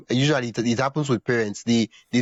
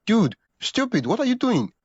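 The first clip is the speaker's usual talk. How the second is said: mock exasperated scold; 11 semitones higher than usual. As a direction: play the frustrated friend who means well. Scolding someone out of love.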